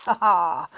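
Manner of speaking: a cheeky little fairy laugh; Bryony laughing at the ruined sunset, cheeky; mischievous laugh. Acted. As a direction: laugh at the trick you played. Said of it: "laughed Bryony".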